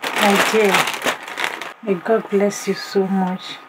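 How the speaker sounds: ordinary talk, nothing marked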